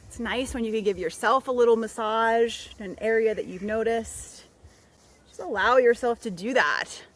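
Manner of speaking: unhurried meditation voice with long pauses; guided meditation. Real, not acted. Guide leading listeners through a body scan, calm meditative voice. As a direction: ease them through it, phrase by phrase.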